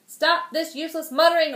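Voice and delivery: impatient king silencing the magicians; loud royal order. Acted. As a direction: snap it at the magicians. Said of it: "ordered the king".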